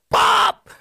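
high-pitched raging mother, imitated; mock threat. Mother choking the sisters, mock high-pitched threat. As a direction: yell it very high, hammed up. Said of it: "choking on my sisters like".

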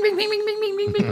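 high, rapid mock pinging of rubber pellets; ping it out like pellets ricocheting; imitated pellet pings. Officer imitating grenade pellets bouncing in a cell, high mock pings. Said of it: "you'll just hear bing".

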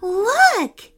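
high, loud excited call. A narrator's reading. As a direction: point it out full of excitement. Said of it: "said Nea excitedly".

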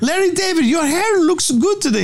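very high, hammed-up flattering voice; high mock Mengele voice. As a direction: squeak the compliment like a mock Nazi doctor. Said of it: "he does this thing of".

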